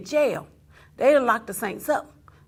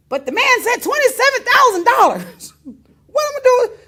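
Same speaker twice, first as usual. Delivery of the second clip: raised voice; 11 semitones higher, 8 dB louder than usual. Retold or imitated. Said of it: "his voice raised a little bit".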